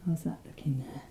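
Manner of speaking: soft whisper check-in. Real, not acted. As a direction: whisper so as not to disturb. Checking on Ollie lying down.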